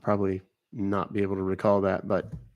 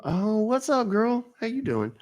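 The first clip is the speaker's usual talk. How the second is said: high, happy hello to a friend; excited greeting; 9 semitones higher than usual. Real, not acted. Greeting a viewer he recognizes.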